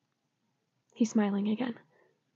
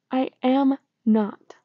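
slow, louder, groaning insistence; exasperated groan; 4 semitones higher, 6 dB louder, slower than usual. Acted. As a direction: drag it out, fed up. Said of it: "I groan".

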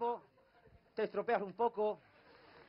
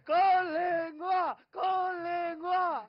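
loud, drawn-out banquet chant; slow, high, hollered cheer; barbaric wedding shouts; 8 semitones higher, 8 dB louder, much slower than usual. Acted. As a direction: bellow like the rowdy friends' table. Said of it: "salen los gritos más bárbaros".

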